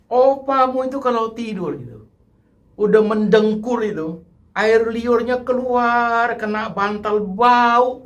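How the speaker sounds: a lightly voiced high grandma grumbling; mild mock complaint from an old grandma; complaining grandma. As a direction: whine about grandpa's drool like a fed-up grandma.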